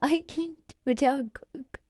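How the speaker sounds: small, tearful voice; acted sobbing; crying. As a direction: choke the words out through sobs. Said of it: "क्यों रो रही हो तुम".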